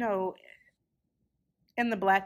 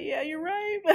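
imitated tearful agreement; 9 semitones higher than usual. Voicing the crying graduate agreeing.

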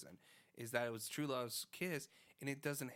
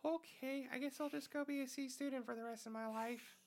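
put-on high, sheepish little kid voice; 12 semitones higher than usual. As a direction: play a small child asking innocently. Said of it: "I didn't know I had a good kid voice like that".

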